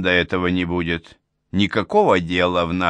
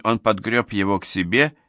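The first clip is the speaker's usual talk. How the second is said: plain reading voice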